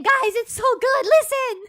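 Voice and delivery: hammed-up excited yell of a fan; mock fan scream. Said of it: "repeatedly saying".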